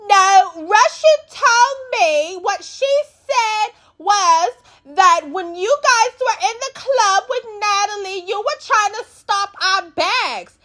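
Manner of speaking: high, slow put-on explaining voice; a high, drawn-out imitation of someone pleading. Elsie explaining herself to Dolly, high and slow. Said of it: "Elsie get to trying to explain".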